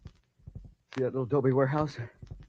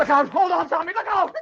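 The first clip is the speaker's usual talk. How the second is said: loud, high warning yell; alarmed shout; 14 semitones higher, 8 dB louder than usual. Acted. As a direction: scream a warning to a falling friend. Sailor falling from cliff.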